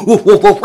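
high, loud woofs; dog barking. Acted. Storyteller voices a dog chasing the cat, loud high barks. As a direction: bark like an excited dog.

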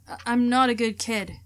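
normal talking voice

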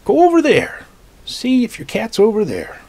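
put-on a bench man calls the boy onward; calling out directions.

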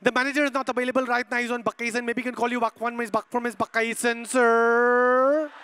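put-on rapid Filipino-accented excuse ending in a long-held sir; fast mock-Filipino patter; Filipino phone operator. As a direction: rattle off excuses as a Filipino receptionist. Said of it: "in the end they'll say".

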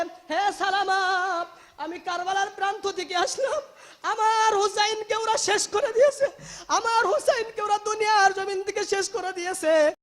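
high, loud chanted wail; weeping lament. Retold or imitated. Grieving Prophet tells Salama that Husain is killed, wailing. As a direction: cry it out as a tearful preaching chant. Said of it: "আপনি কেন কান্নাকাটি করতেছেন".

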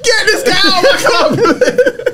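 high, slow quote broken by laughter; imitating a friend while cracking up; high laughing mock outrage.

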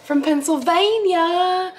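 high, drawn-out excited announcement. Real, not acted. Vlogger announcing her bestie's Christmas gifts, excited. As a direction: stretch the words out high with delight.

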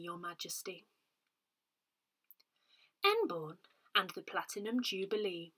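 normal talking voice

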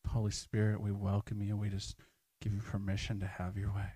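hushed altar-call guidance; guided prayer. Acted. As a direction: guide them into quiet prayer. Leads prayer time.

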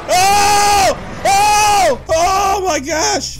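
high, loud screams of fright; terrified shouts. Acted. Player watches the wolf attack friends, terrified shouting.

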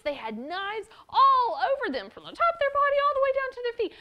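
storyteller swept up in excitement; building excitement. A narrator's reading. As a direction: pile it on with growing awe. Building excitement describing armed men.

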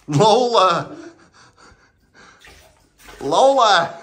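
high, loud call of the name; scolding call. Real, not acted. Dog keeps drinking bath water, loud high scolding. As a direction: call out sharply to stop the dog.

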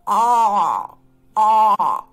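"A" as a loud, deep open back vowel; teacher showing the doctor's-office A. Shown as a demonstration. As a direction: open wide and say "A" far back. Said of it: "Abrimos la boca".

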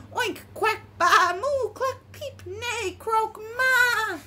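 high, playful barnyard medley; animal noises. A narrator's reading. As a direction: give each animal its own squeaky call. All the animals sound off.

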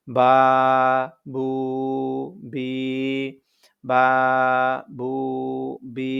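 বা, বু, বি each held for one alif; drawn-out vowels. Shown as a demonstration. Teacher demonstrating madd letters বা, বু, বি, long held vowels. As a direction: stretch the vowel evenly in বা, বু, বি. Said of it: "এক আলিফ টেনে পড়তে হবে".

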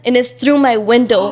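ordinary talk

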